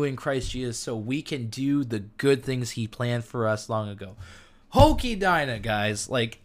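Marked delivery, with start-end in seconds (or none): 4.7-5.6 high, loud burst of amazement; amped up. Real, not acted. Preacher marvels to his listeners at the passage, amped up. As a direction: exclaim it, bowled over. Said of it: "I'm getting way too amped".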